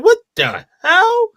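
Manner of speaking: stunned what the hell. Retold or imitated. Reacting to the spaghetti scene, high squealing disbelief. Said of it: "I'm like".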